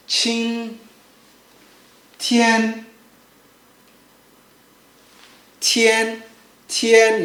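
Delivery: CHIN and TIAN each held long on a level pitch; chin, tian, flat as a line; first tone. Shown as a demonstration. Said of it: "make sure it's flat".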